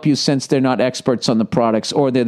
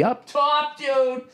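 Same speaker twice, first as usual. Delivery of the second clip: high up top; 12 semitones higher, 5 dB quieter, much slower than usual. Pretend. High five guarantee joke.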